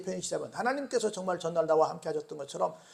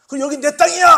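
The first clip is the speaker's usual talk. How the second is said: mock boastful enemy; 8 semitones higher, 12 dB louder, faster than usual. Voicing the boastful enemies.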